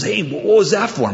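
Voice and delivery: baffled protest. Acted. Punched man to his attacker in a joke, baffled. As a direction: demand a reason, pitch jumping up.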